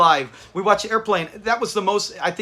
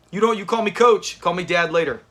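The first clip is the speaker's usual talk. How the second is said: normal talking voice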